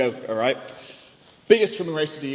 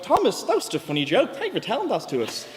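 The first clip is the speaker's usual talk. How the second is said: mock gushing; 7 semitones higher than usual. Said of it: "I'm like".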